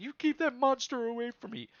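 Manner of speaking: high frantic plea to keep the monster away; terrified plea. Acted. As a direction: cower and beg, voice pitched high. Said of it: "he's looking at the stormer like".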